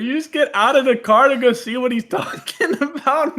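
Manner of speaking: high, laughing through it. Real, not acted. Host laughing at himself over the traffic stop, high. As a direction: laugh at your own dumb move. Laughing at own dumb move.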